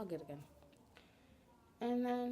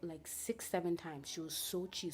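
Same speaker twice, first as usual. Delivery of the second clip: usual speaking voice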